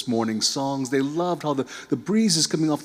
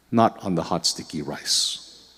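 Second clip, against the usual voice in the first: plain reading voice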